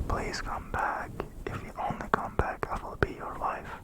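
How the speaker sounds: ordinary delivery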